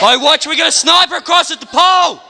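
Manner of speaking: high urgent callout; warning shout. Real, not acted. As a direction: yell out the sniper position. Warning of sniper.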